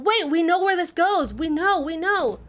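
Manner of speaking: very high, loud eureka; excited shout. Real, not acted. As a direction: shout with sudden discovery. Sudden discovery, shouting.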